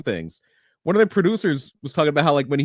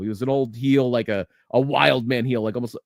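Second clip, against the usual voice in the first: normal talking voice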